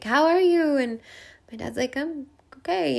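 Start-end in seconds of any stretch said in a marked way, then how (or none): 0.0-0.8 lightly voiced self on the phone, higher and louder; a light imitation of her younger self calling; 1 high quote of herself. Calling her dad after the dream, a touch high and loud. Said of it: "I'm like". 2.0-3.0 put-on Hungarian accent. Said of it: "in his Hungarian accent".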